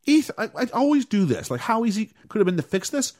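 high exasperated groan at the plot. Real, not acted. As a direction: lay out the obvious fix in disbelief. Exasperated fix suggestion.

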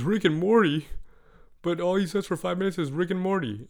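put-on high, loud disbelieving kid voice; incredulous kid. Boy mocking the rap song choice, incredulous. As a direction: kid scoffing at the answer.

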